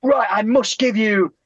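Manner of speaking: put-on high, eager old man voice; old man insisting. Retelling the grateful old man pressing gifts on him. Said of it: "he was like".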